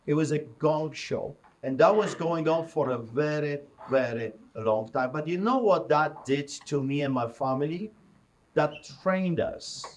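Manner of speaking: ordinary talk, nothing marked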